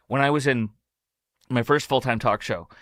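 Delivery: ordinary talk, nothing marked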